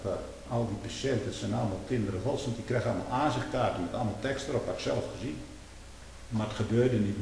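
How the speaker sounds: ordinary delivery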